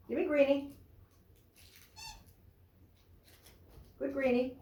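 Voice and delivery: high, coaxing voice for the dog; sing-song dog talk. Real, not acted. Praising the dog.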